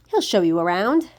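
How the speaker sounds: plain reading voice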